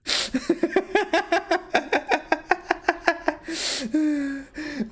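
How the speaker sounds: high-pitched real laughter. Real, not acted. Laughs at robot voice again.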